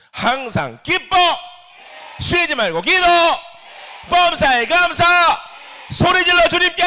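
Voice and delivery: high, loud, slow shouted commands; full shouting proclamation. Shown as a demonstration. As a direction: roar each line to the congregation. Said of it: "다시 한번 해보겠습니다". (아주 높고 크게 외치는 선포)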